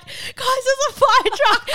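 high, loud call to the group on the beach. Retold or imitated. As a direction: shout it across the sand, frantic. Shouting to friends on beach.

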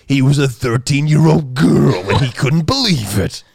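slow, loud, high narrator voice; copying narrator voice. Retold or imitated. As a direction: copy the creepy reader, drawn out. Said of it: "copy the voice".

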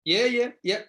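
high, loud eager yeahs; excited agreement. Real, not acted. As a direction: jump in agreeing with real excitement. Eager agreement about kit bags.

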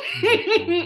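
high laugh through tears; tearful laugh. Real, not acted. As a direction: laugh to keep from crying. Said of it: "I'm not gonna cry".

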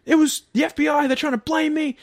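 high, loud yelling accusation; explode, shouting at the FBI; furious outburst. Retold or imitated. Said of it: "And he's going off".

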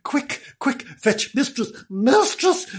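high, hushed, pleading call for help; feigned urgency. Acted. Bernard fakes pain, begs for help.

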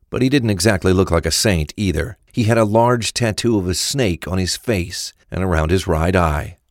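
ordinary delivery

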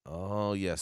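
ordinary talk, nothing marked

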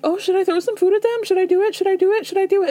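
imitating a giddy rich lady; mock eager voice. Host voicing Lisa eager to feed the dogs, giddy. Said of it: "she starts being like".

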